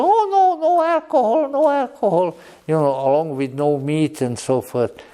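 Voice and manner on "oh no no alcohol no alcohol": very high, squeaky protest; hammed-up falsetto horror; mock prim objector. Lecturer mimicking teetotal followers refusing wine, mock squeal. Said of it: "if you are one of these people".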